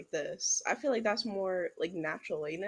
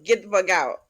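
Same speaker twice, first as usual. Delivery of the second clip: loud angry yell; 8 dB louder than usual. Acted. Tower witch yelling at intruder, loud. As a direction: bark them off the property. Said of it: "I start yelling at you".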